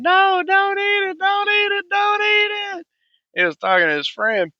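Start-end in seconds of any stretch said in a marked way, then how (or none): 0.0-2.9 put-on high-pitched, louder child's shriek, stretched out slowly; mock kid scream. Little boy yelling at his friend at lunch, high shriek. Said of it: "started screaming".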